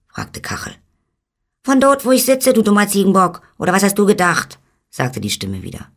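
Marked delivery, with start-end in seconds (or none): 1.6-4.6 very high, loud, insulting tiny-man voice; irritated little man. Acted. Little man insults the billy goat, high and irritated. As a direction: snap back as a tetchy little sprite.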